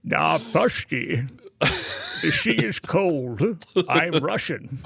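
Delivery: slow, mock-Russian Scottish accent; hammed-up Connery attempting a Russian accent; Sean Connery. Podcaster mocking Hunt for Red October's accent, slow bad Connery Russian. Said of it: "Sean Connery trying to do a, a Russian accent".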